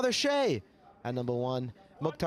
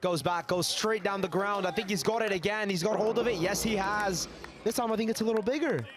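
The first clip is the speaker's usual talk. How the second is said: excited commentary; 7 semitones higher than usual. Real, not acted. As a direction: build the call as the ball flies. Live play-by-play rising excitement.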